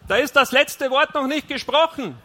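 loud, high, indignant call; calling after her. A narrator's reading. Husband calls after wife leaving for bed, indignant shout. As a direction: throw it angrily after her. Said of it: "rief ich ihr nach".

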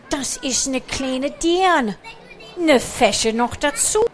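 Pomme de Terre. Acted.

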